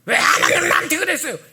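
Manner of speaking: high, loud whiny protest; mock complaint; complaining voice. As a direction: whine at God. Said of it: "이거 아니에요".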